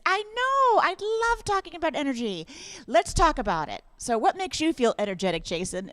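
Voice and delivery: high-pitched overeager fake enthusiasm; mock gushing. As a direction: gush at the robot like a fan. Mock eager answer to robocall.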